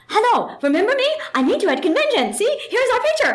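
put-on high, eager Japanese-accented English, broken grammar, laughing at the end; Japanese accent impression. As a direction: play the second fan in a Japanese accent, high and earnest. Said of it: "my embarrassing Japanese accent".

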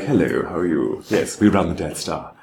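mock-polite British villain; British movie villain. Movie villains introducing themselves, politely British. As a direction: put on a villain's British accent. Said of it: "every villain in every American movie is British".

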